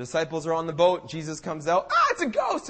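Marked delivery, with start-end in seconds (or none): 1.9-2.7 high-pitched mock panic; freaking out. Disciples seeing Jesus on the water, mock panic. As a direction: yelp it like a terrified disciple, hammed up. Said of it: "they're freaking out".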